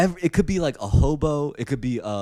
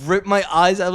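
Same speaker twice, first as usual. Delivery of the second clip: ordinary delivery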